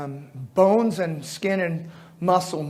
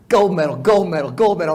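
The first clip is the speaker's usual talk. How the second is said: triumphant listing; 6 dB louder than usual. Retold or imitated. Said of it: "raining on me".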